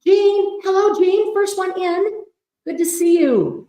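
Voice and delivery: high, loud singsong hello; excited greeting. Real, not acted. Host greets the first viewer in, singing it out high and thrilled. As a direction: sing out a delighted welcome. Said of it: "although I am excited".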